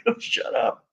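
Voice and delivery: high, soft, strained hushing; urgent hushed plea. Retold or imitated. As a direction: shush a friend in a panic, keeping it down. Hushing the loud buddy in fear.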